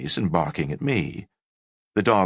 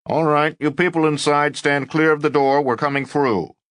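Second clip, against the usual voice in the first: a hint of a loud police megaphone; loudspeaker voice; 9 semitones higher, 4 dB louder than usual. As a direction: announce it through a loudspeaker. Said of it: "loudspeaker voice".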